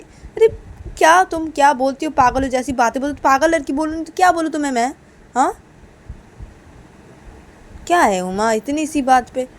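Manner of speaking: acted quarrel, voice pushed up; exasperated arguing. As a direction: argue back heatedly. Sanju argues back heatedly.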